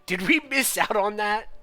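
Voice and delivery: loud, high, slowed indignant question; mock outrage. Real, not acted. Streamer protesting the game's twist, loud and high. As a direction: play up the outrage.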